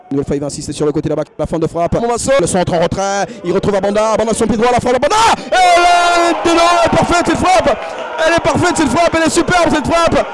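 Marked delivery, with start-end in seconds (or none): 3.8-10.3 shouted goal call, high; excited sports commentary. Real, not acted. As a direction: yell it out, thrilled.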